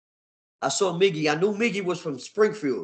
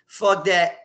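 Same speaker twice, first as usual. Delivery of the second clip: loud, higher dismissal; defiant refusal; 5 semitones higher, 8 dB louder than usual. Retold or imitated. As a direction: throw it out loud, brushing them off.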